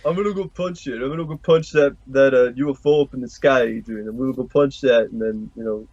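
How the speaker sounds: lightly voiced slurring boxer; Rocky impression. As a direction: mumble like a punch-drunk fighter. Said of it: "Ah, Rocky".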